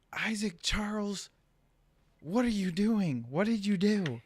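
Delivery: high, drawn-out scolding mom; mad mom voice. Retold or imitated. Mom catching her son misbehaving, mad mom voice. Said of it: "like, mad mom voice".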